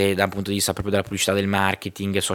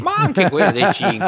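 laughing; 9 semitones higher, 6 dB louder than usual. Real, not acted. Laughing joke about tea.

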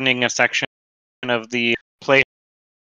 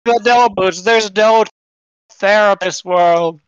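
high, louder character voice; hammed-up lament; 7 semitones higher, 5 dB louder than usual. Patient lamenting a world without therapists, hammed-up lament. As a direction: lament it in a pitched-up voice.

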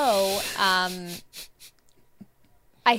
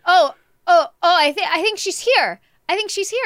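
put-on high, loud, flustered kid; imitated excitement; 7 semitones higher, 6 dB louder than usual. Mom imitates son at front door seeing guest arrive, flustered excitement. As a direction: imitate a boy bursting out that the guest has arrived. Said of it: "like, real emotions like this".

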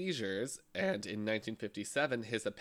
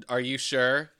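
rude salesclerk; 3 semitones higher, 7 dB louder than usual. Pretend. Mocking rude makeup clerk.

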